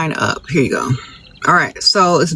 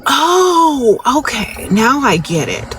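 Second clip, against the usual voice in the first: nice nasty; 5 semitones higher, much slower than usual. Pretend. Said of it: "nice nasty at the same time".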